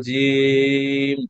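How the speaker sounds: জিম held very long, vowel slowly stretched; long drawn jim. Shown as a demonstration. Teacher recapping for class, stretching জিম long. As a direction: draw জিম out slowly like a chant. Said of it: "জিমটাকে আমরা লম্বা করে পড়বো".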